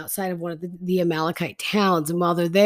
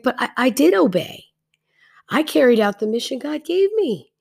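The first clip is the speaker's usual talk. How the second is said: high-pitched mock indignation; defensive protest; 6 semitones higher than usual. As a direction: protest your innocence in a raised pitch. Saul protesting the accusation.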